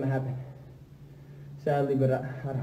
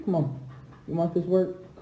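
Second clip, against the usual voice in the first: usual speaking voice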